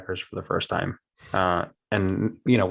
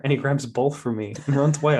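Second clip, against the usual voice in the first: normal talking voice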